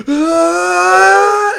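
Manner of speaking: long, high, very loud fan whine; console noise imitation. Gamer imitating his Switch suddenly roaring, piercing sustained wail. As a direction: shriek like an overworked console fan. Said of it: "whisper quiet and it goes".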